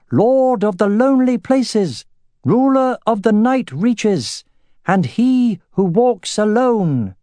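high, slow, quavering herald announcement; thin warbling voice. A narrator's reading. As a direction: proclaim every title in a thin, wavering voice. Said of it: "in a thin, warbling voice announced".

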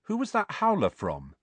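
high, insistent question; boy's questioning. A narrator's reading. Boy asking his aunt about the letter, insistent. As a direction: press her for the answer.

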